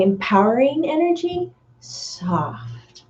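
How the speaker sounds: whispered softly. Shown as a demonstration. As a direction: let the words fade into a whisper. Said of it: "keep that really strong ... soft".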